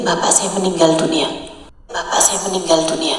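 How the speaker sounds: quiet, understated sorrow; hushed grief. Retold or imitated. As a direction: let the voice sink sorrowfully. Retells finding the father dead.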